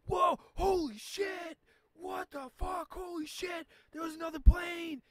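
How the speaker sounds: high-pitched mock shriek of shocked onlookers; imitated shocked shouting. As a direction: yell it stretched out, playing a stunned bystander. Acting shocked bystanders in crash videos.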